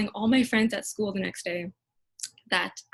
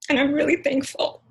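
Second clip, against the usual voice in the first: holding back tears; 6 semitones higher, 4 dB louder than usual. Real, not acted. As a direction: voice catching, pitched up and louder, fighting tears. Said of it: "Okay, I'm trying not to cry".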